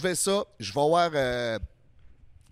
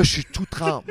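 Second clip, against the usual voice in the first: normal talking voice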